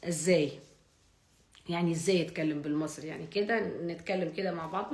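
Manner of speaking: usual speaking voice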